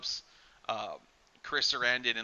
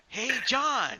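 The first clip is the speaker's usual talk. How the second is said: voodoo doll voice; 9 semitones higher, 4 dB louder than usual. Retold or imitated. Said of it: "he's like".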